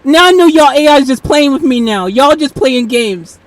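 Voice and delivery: loud, knowing call-out; loud accusation. Real, not acted. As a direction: call them out at full voice. Accusing the AI of games.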